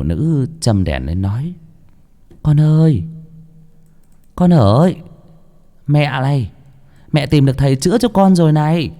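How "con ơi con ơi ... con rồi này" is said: high, calling mother's voice; pleading mother. Acted. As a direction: coax the sick son. (giọng mẹ cao, gọi con tha thiết)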